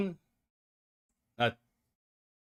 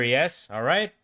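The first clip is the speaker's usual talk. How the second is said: ordinary talk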